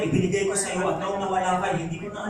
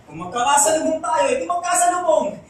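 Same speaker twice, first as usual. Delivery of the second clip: higher, louder defiant declaration; defiant outburst; 8 semitones higher, 6 dB louder than usual. Acted. Telling an ex they may cross paths, defiant. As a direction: throw it out loud and high, daring the ex.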